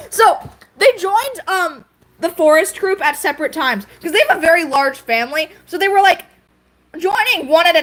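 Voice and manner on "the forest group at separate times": usual speaking voice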